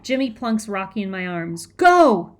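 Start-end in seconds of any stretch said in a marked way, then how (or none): 1.7-2.4 sharp, very loud high shout; explosive urgent command. A narrator's reading. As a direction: bellow it to send someone running. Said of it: "he shouts in my ear".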